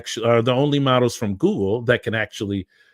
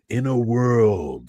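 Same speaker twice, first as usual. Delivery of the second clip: movie trailer voice; slower than usual. Retold or imitated. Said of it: "last generations to hear".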